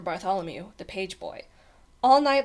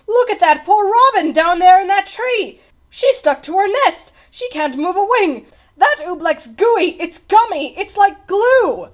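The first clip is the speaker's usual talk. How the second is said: distressed cry; 10 semitones higher, 12 dB louder than usual. A narrator's reading. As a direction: cry out in horror for the robin. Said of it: "Bartholomew cried".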